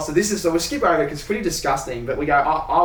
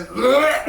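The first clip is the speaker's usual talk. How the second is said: higher, laughing gag noise; mock retching bleh; 8 semitones higher than usual. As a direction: imitate a man gagging at a stink. Plumber retching at the smell.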